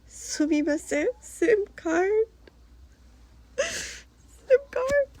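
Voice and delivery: high, halting questioning voice; tentative asking. Retold or imitated. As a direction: ask timidly in a high, softened voice. Acting out asking for sim card.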